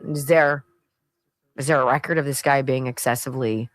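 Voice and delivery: normal talking voice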